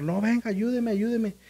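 high pleading cry for help; imitating a frantic mother; desperate plea. Mother begs reporter for help.

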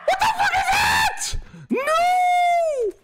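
high screaming in fright; terrified scream. Real, not acted. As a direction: shriek at the monster. Monster appears.

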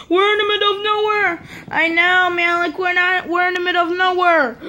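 high, whiny scared toy voice; high-pitched play-acted alarm; pretend panic. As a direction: wail as a lost, frightened figure. Scared figures lost after roar.

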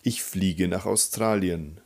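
normal talking voice

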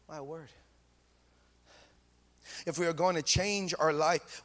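ordinary delivery

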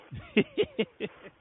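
high-pitched happy laughter; delighted laugh. Real, not acted. Host glad to hear a fellow elder, high delighted laugh. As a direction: laugh high after a fond greeting.

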